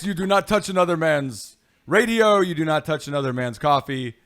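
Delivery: hammed-up stern warning, easing slightly; mock scolding. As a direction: lay down the law in mock indignation. Mock scolding over stolen coffee.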